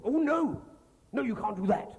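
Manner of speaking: high, loud alarmed official. Retold or imitated. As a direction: yelp it like a panicked civil servant. Official voiced in phone prank.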